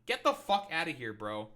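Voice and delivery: usual speaking voice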